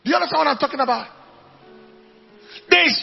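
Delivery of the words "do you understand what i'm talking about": ordinary talk, nothing marked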